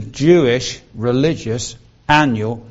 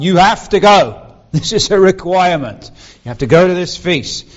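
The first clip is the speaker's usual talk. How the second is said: stern command; 4 semitones higher, 5 dB louder than usual. Retold or imitated. Preacher voicing the Exodus feast command, loud and insistent. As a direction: lay down the rule like a lawgiver.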